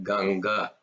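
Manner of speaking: "Ganga" with plain unaspirated g sounds; no emphasis. Shown as a demonstration. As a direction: say "Ganga" without any puff of air on g. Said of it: "There's no emphasis on that".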